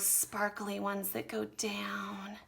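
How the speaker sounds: drops to a slow whisper. Real, not acted. Art teacher to young students, slow whisper. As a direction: breathe it out as the sparks fall. Drawing sparkly fireworks falling down.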